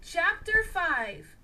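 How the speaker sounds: ordinary delivery, nothing marked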